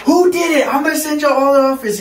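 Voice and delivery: high, fast mock yell; teacher going off. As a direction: imitate a teacher's furious threat, pitch jumping up. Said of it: "He was going off on us".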